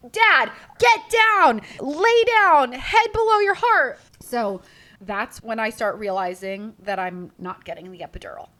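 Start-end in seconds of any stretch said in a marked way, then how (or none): 0.1-4.0 loud, high, slow commands; urgent order. Retold or imitated. Nurses ordering the fainting husband down, loud and high. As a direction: bark it at the fainting dad.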